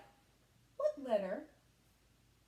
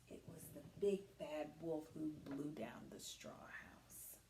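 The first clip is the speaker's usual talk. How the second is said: soft, lower whisper; whispered answer; 9 semitones lower, 7 dB quieter than usual. Real, not acted. Teacher revealing the answer, soft whisper.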